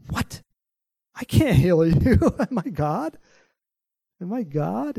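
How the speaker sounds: king of Israel protesting in disbelief; loud, high, incredulous protest; shocked king. Acted. King refusing Naaman, loud and disbelieving.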